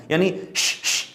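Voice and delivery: a soft, spread "ش" hush; spread hiss demo. Shown as a demonstration. Teacher showing students the spreading sound, soft hush. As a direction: breathe out a wide "ش". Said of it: "پھیلاؤ کے ساتھ ادا ہوگا".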